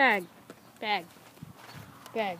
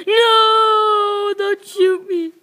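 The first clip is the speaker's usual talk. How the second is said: long high wail into a plea; pretend terrified shout; 7 semitones higher, 7 dB louder, much slower than usual. Soldier begging someone not to shoot, high loud mock panic. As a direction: cry out in play terror.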